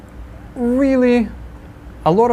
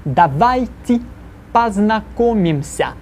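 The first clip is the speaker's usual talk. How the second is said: slow, deliberate model for students to repeat; each syllable spelled out slowly so the class can echo it; slow repeat-after-me; 4 semitones higher, slower than usual. Shown as a demonstration. Teacher models the phrase slowly for students to repeat. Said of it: "this painfully slowly, that means I'd like you to repeat it".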